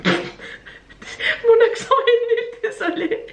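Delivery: high, drawn-out words through laughter; laughing. Real, not acted. Vlogger mocking her own English accent to viewers, laughing. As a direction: giggle helplessly through the apology.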